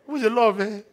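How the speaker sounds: high, loud mock sobbing over a love story; hammed-up tearful outburst; mock crying. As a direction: wail it like an over-emotional movie viewer. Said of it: "you cry".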